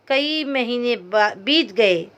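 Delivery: ordinary talk, nothing marked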